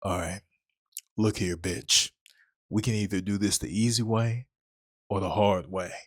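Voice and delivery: low, soft menacing monster; monster up close. Acted. Monster leaning in real close to threaten Rose, low and quiet. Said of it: "leaned in real close and it said".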